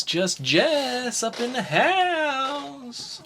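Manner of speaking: drawn-out, high announcer call, stretching every word; a slow, sing-song hype-man shout-out. Acted. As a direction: boom it out like an arena announcer introducing a star. Hype-man intro for cohost.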